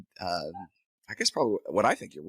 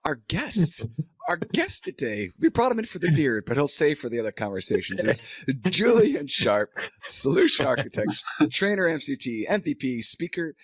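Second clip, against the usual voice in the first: guest announcer; 5 semitones higher than usual. Real, not acted. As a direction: announce the guest with a big build-up. Grand introduction of guest.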